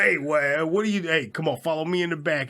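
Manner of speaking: host playing the greasy cook offering food; big Italian cook. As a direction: wave him into the back like a pushy cook. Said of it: "he's like a big fat Italian guy".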